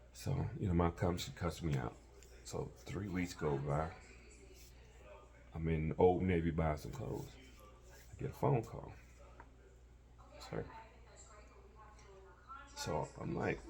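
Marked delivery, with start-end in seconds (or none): none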